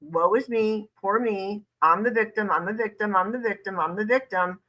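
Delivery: singsong parody of a victim; mocking poor-me whine; mock self-pity. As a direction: chant it like a tired refrain of complaints. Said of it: "remember in this context, because he does it throughout".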